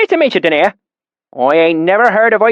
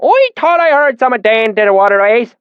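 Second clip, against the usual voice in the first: put-on deep valley accent; 11 semitones higher, 5 dB louder than usual. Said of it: "his accent so thick and oily".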